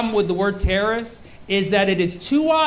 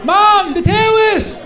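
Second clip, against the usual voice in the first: high, loud mock toddler voice; toddler tantrum; 12 semitones higher, 4 dB louder than usual. Mock toddler pleading to Mom about the terrorists, escalating whine. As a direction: wail like a kid protesting to Mom, drawing out the last word. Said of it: "say it like a toddler".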